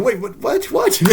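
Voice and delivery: high, rising squeaky disbelief; baffled. Retold or imitated. Detective Father stunned by the accusation, squeaky disbelief.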